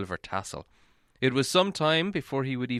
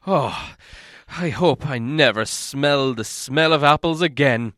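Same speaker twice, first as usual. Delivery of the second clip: louder, raised complaint; complaining; 3 semitones higher, 7 dB louder than usual. Acted. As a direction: grumble loudly like a sore young dwarf. Fili complaining about apples.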